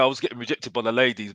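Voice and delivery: ordinary delivery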